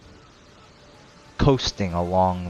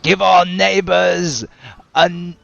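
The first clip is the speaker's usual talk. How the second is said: yelling captain; 9 semitones higher, 6 dB louder than usual. Acted. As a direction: call it out to the whole crew. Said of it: "you can hear him yell out".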